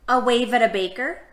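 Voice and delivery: lilting read-aloud for little listeners; reading to children. Acted. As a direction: read each picture-book line gently to the kids. Reading picture book to kids.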